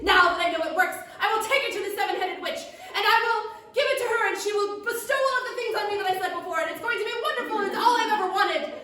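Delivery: loud, high, triumphant boasting; gloating excitement. Acted. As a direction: revel in your grand plan at full volume. Gabe gloats about his potion.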